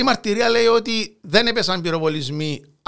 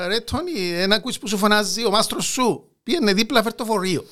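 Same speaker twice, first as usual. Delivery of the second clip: put-on Cypriot Greek accent; 4 semitones higher than usual. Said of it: "στα κυπριακά".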